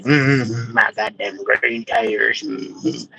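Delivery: low, soft imitation of the actress. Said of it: "she talking like this".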